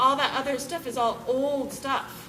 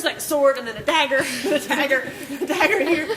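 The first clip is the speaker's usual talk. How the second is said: louder, laughing through the words; 5 dB louder than usual. Real, not acted. A speaker laughing to the crowd about daggers, laughing. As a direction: laugh while you pile on daggers. Laughing while listing weapons.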